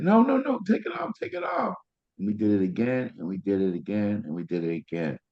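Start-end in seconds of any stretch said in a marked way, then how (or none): 0.0-1.7 much higher panicked shout; wife's panicked plea. Retold or imitated. Husband voicing his wife begging to remove the patch, panicked shout. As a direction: shriek it like she wants it off now. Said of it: "And my wife said".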